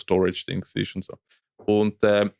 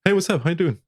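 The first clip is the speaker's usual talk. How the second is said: eager greeting; 8 semitones higher, faster than usual. Retold or imitated. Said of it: "Ich so".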